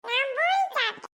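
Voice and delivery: put-on high little child voice; reply in a tiny child's voice; small child. Child telling uncle they have eaten, small child voice.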